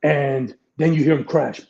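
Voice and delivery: ordinary talk, nothing marked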